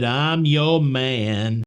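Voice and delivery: slow, drawn-out crooner quote. Retold or imitated. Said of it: "like [name] said".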